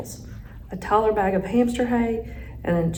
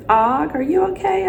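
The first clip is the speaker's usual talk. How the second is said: raised, higher-pitched call to a friend; 8 semitones higher, 4 dB louder than usual. A narrator's reading. Hamster checks on hungry frog friend, calling out. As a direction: call out with a lifted, questioning voice. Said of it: "I called out".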